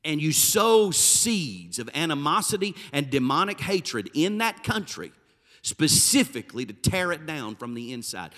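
ordinary talk, nothing marked

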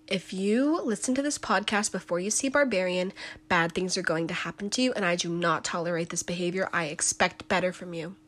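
soft stern scolding parent; stern mom voice. Shown as a demonstration. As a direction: scold them like a disappointed mom. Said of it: "I'm channeling my inner mom energy".

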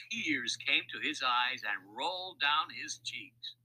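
plain reading voice

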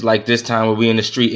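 usual speaking voice

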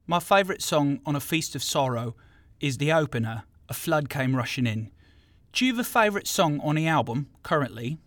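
put-on South London accent; American having a go at South London. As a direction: ask it as a would-be South Londoner. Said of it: "in my best South London accent".

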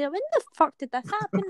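retold outrage at cinema hot dogs; shocked disbelief. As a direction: act stunned at what people eat now. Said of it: "I was like".

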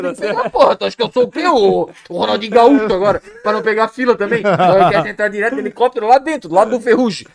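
loud, sarcastic telling-off; mock scolding. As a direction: scold the complainer loudly, as a retold row. Acted scolding of complaining partner. (bronca encenada em voz alta)

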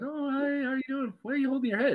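high, sing-song mom voice; cheery mom. Retold or imitated. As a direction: imitate an unsuspecting mom's high greeting. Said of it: "and she's like".

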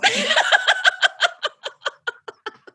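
loud high cackling laugh. Real, not acted. Laughing at her own outburst, loud high laughter. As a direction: burst out laughing.

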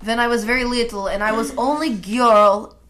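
lightly held mock Russian accent; bad Russian accent; telling a story in a bad Russian accent. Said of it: "this horrible Russian accent".

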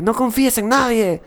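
high, slow, exaggerated warning; mock alarmed warning. Warning oneself to trust nobody, hammed-up high voice. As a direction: stretch it out high like a dramatic caution. Acts out own past distrustful warning.

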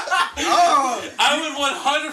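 laughing. Real, not acted. Laughing while teasing friend.

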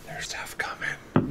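hushed whisper close to the ear; whisper in left ear. Acted. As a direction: lean in and whisper it. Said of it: "as she kind of whispers into your ear".

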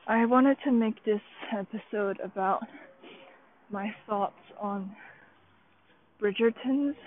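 normal talking voice

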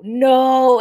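sudden loud no. Real, not acted. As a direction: blurt it out loud. Stopping own silly idea.

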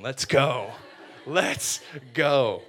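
loud, punched celebration; triumphant cheer. Acted. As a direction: cheer a big win. Acted cheer at brother's phone.

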